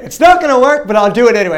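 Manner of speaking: high, loud exasperated concession; hammed-up sassy surrender; grudging prayer. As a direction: give in loudly and with attitude. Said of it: "That's how I said it".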